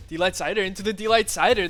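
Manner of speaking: loud, fast hype call; excited shout. Real, not acted. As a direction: rattle off the combo at full hype.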